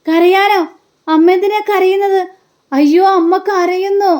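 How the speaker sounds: high-pitched, drawn-out mock amazement; acted astonishment. Son learns his mother is crying, exaggerated astonishment. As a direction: imitate a son who can't believe it.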